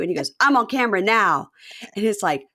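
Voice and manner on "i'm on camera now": louder, higher irritated announcement; mock yelling. Husband telling wife he's on camera, mock yell. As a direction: imitate him snapping into the phone. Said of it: "He's yelling at the camera".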